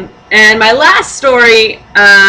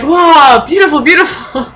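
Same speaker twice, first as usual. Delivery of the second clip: put-on awed old man; 7 semitones higher than usual. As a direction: gush like a charmed old man. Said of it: "he's like".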